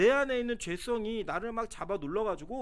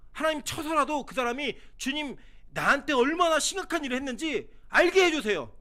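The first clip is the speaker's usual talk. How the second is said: resentful prayer; 6 semitones higher, 5 dB louder than usual. Retold or imitated. Imitating vengeful prayer.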